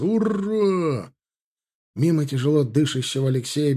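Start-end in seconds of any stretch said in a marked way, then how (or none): 0.0-1.1 long, high hurrah; soldiers' battle cry. A narrator's reading. Infantry take up the cry, drawn-out battle cry. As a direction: stretch the cheer high, charging. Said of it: "подхватили клич пехотинцы".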